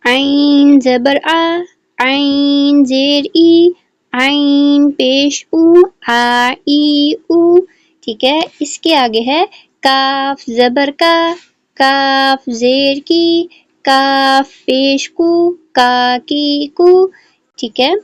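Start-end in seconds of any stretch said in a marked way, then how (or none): 0.1-7.6 slow, drawn-out syllable drill; slow drill reading. Shown as a demonstration. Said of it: "اس کو ہم ریڈ کریں گے". 9.8-17.1 slow, drawn-out syllable drill; slow drill reading. Shown as a demonstration. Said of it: "اس کو ہم ریڈ کریں گے".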